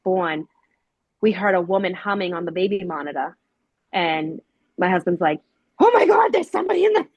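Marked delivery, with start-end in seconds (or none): 5.8-7.1 much higher and louder, alarmed gasp of a voice; panicked husband. Retold or imitated. Husband hearing a stranger on the baby monitor, panicked. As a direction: blurt it out like you just heard an intruder.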